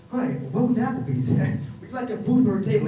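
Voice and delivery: bright, high restaurant host voice; chirpy hostess welcome; cheery host greeting. Retold or imitated.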